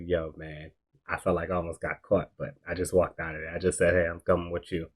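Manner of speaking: hushed nervous retelling; quiet. Retold or imitated. Retelling a whispered report to Dirk.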